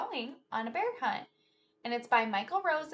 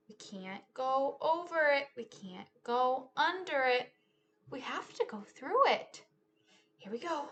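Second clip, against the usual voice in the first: lively, sing-song storytime read; animated picture-book telling; reading to children; 3 semitones higher, 11 dB louder, slower than usual. Acted. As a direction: act out the story for a class of kids. Teacher retells picture book with moves.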